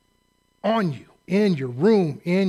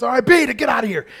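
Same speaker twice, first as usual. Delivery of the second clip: dismissive yell; 7 semitones higher, 5 dB louder, faster than usual. Retold or imitated. Staff dismissing cadet.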